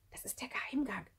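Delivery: soft, secretive whisper. A narrator's reading. Sami reveals the secret passage, hushed whisper. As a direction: whisper it without voice. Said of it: "flüsterte Sami".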